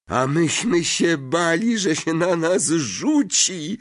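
put-on a boy patting his car's bonnet fondly; relieved call. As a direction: laugh off the old fear. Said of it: "zawołał Ron".

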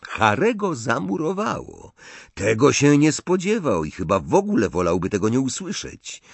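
ordinary delivery, nothing marked

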